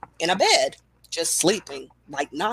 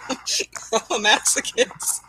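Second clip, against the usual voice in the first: laughing; 3 semitones higher, much slower than usual. Real, not acted. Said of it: "why are you so tough yet so sensitive".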